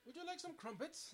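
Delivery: high, hammed-up British accent; mock British accent. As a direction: offer crumpets as a mock British waiter. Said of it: "you have to talk like British".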